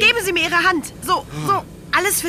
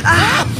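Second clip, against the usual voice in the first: loud startled shout; startled cry; 5 semitones higher, 6 dB louder than usual. Acted. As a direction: cry out as the plane shakes. Turbulence hits the plane.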